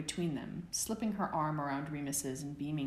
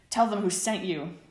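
grunted; 4 semitones higher, 8 dB louder than usual. A narrator's reading. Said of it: "James grunted back".

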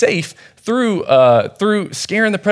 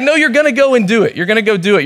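higher-pitched mock-stern insistence; insistent order; 6 semitones higher, 4 dB louder, faster than usual. Dad telling his son to preach, mock-stern insistence. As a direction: lay down the law as the retold dad.

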